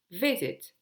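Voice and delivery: "visit" punched on its first syllable, falling after; stress on first syllable. Shown as a demonstration. English teacher giving an example to learners, "visit" stressed on its first syllable. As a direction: hit the start of "visit". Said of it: "j'ai insisté sur la première syllabe".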